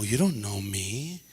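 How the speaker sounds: low, slow defensive retort; defensive mutter. Retold or imitated. Student brushing off the mentor, low and slow. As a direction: mumble a touchy denial.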